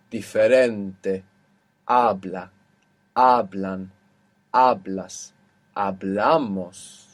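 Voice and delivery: "diferente, habla, hablan, hablas, hablamos", each slowly stressed on the second-last syllable. Shown as a demonstration. Teacher giving examples of the rule, stressing second-last syllables in "diferente, habla, hablan, hablas, hablamos". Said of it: "the second last syllable".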